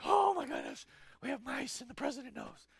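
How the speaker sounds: hammed-up dismay; mortified shock. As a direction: play horrified embarrassment, starting loud and high. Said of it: "And you're like".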